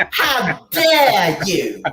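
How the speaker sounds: high, very slow indignant quote; Greta Thunberg impression. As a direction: drag out the outrage. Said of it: "when you do this voice".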